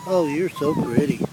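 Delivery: squeaky high cooing to a puppy. Real, not acted. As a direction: falsetto pet talk. Baby talk to a puppy.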